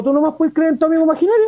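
high, fast teasing comeback; teasing retort. Retold or imitated. Husband teasing his Buddhist wife about her beliefs, high fast teasing. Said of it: "yo le digo".

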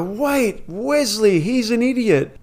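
high squeaky imitation of the onlookers; a high mock gasp of disbelief; high mocking crowd voice. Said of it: "they go".

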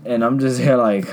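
ordinary delivery

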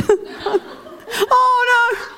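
very high laughing oh no; hammed-up high-pitched despair; mock dismay. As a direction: squeal it in playful panic. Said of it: "walking around going".

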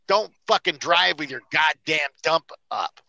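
ordinary delivery

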